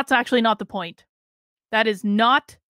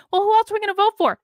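mocking voter; 8 semitones higher, faster than usual.

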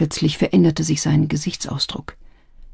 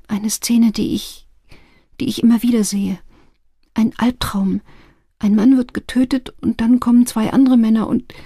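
higher, halting, uneasy voice; troubled dreamer; 6 semitones higher than usual. A narrator's reading. Client tells Madame Chang of a nightmare, troubled. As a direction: recount it haltingly, voice lifted.

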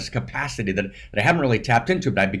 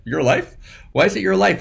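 mock incredulous; 7 semitones higher than usual. The little voice scoffing at him, mock incredulous. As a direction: scoff at the very idea, lightly. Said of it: "This little voice, right?".

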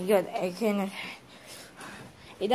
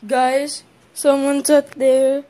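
loud, urgent warning; alarmed call; 8 dB louder, slower than usual. Acted. Warning viewers someone is outside, alarmed.

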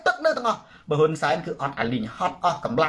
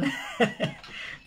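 soft, breathy chuckle; short laugh; 9 dB quieter than usual. Real, not acted. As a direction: chuckle quietly at your own remark. Laughs at own leader pun. (សើចតិចៗ)

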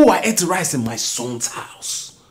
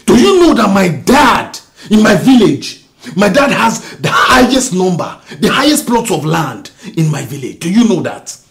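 much higher, loud angry yelling; furious shout; 7 semitones higher, 9 dB louder than usual. Acted. Husband to wife about his father, furious shout. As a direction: yell it in her face.

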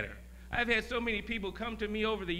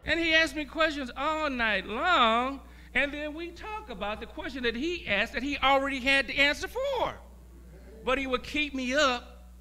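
rising preaching; 9 semitones higher, 4 dB louder than usual. Acted. Preacher building up over the all-night questions. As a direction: swell the voice toward the end.

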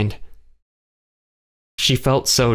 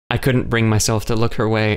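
ordinary delivery

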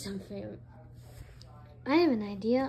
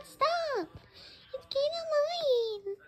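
annoyed; 10 semitones higher, slower than usual. Acted. Other character fed up with chant.

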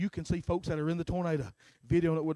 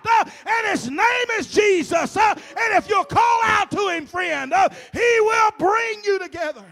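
high, loud, with a gasped uh ending each phrase; fiery preaching; 11 dB louder than usual. Real, not acted. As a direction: preach it full force to the congregation. Preaching at full force.

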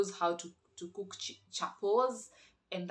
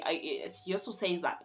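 normal talking voice

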